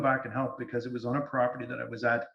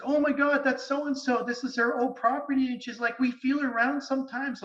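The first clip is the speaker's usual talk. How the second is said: much higher mock exclaiming woman; surprised owner; 11 semitones higher than usual. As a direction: squeal it like the startled host. Imitating the surprised property owner.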